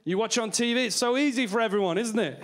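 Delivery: ordinary delivery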